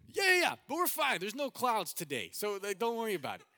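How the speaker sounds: high, emphatic brush-off; loud reassurance. Retold or imitated. Pilot calming his alarmed passenger, loud insistent reassurance. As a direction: talk over his worry, loud and high.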